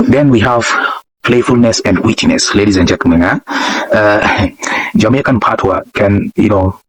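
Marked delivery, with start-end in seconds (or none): none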